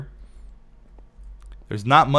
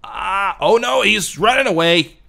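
sudden high, loud cry; startled alarm; 10 semitones higher, 8 dB louder than usual. Real, not acted. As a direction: jolt of alarm as the pet swims off. Pet fleeing, alarm.

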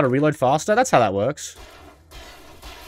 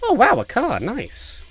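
cheerfully oblivious Alan; 8 semitones higher, slower than usual. Pretend. Playing along as Alan.

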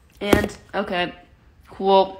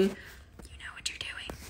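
slow, hushed whisper, far softer; 20 dB quieter than usual. Real, not acted. Owner teasing her cat in a slow sly whisper. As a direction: breathe it like a secret to the cat.

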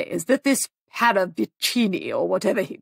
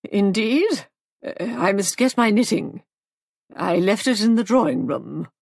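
feeble; 3 semitones higher, 4 dB louder than usual. A narrator's reading. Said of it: "said rather feebly".

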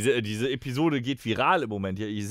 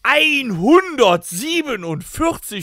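drawn-out incredulous high echo; mock disbelief; 11 semitones higher, 6 dB louder, much slower than usual. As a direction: stretch it out slowly in mock shock.

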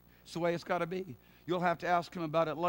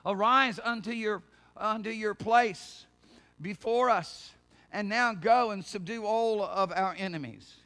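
loud, raised cry to God; priestly invocation; 3 semitones higher, 6 dB louder, slower than usual. Acted. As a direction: call out solemnly like a priest rousing God. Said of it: "The priest said".

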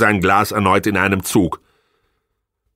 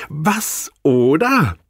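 high, slow scornful taunt; mocking; 9 semitones higher, much slower than usual. Wife mocking husband's threat, slow and sneering. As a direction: drag it out, sneering. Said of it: "rief sie spöttisch".

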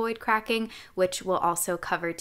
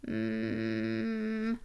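soft hum with vocal fry; creaky hum; 9 dB quieter than usual. Shown as a demonstration. Coach demonstrating an exercise, soft creaky hum. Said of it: "a little bit of vocal fry going".

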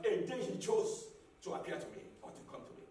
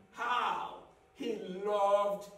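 slow emphasis; 5 semitones lower, much slower than usual. Real, not acted. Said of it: "preacher repeats quote slowly".

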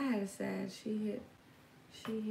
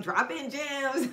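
slow, higher mock viewer voice; imitating viewers; 6 semitones higher, 5 dB louder, much slower than usual. Mimicking her viewers praising her, slow and higher. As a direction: drag it out, teasing the fans. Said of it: "Y'all be like".

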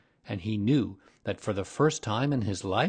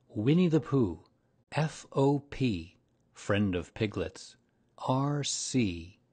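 ordinary delivery

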